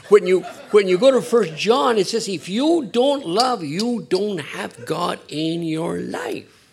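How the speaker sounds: high, loud, slow scripture proclamation; fervent preaching. Real, not acted. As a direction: proclaim the verse like a warning.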